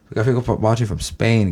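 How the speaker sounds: ordinary talk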